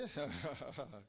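low chuckle; amused laugh. Real, not acted. Preacher amused at Peter's reply, low laugh. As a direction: laugh before giving the answer.

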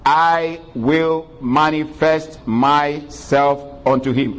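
slow, weighty reading; emphasis. Shown as a demonstration. Pastor stressing the key verse to the church, slow emphasis. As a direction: lean on each word slowly. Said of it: "let me emphasize the last part".

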